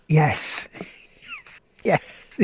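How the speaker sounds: whisper. Real, not acted. Excited whispered agreement.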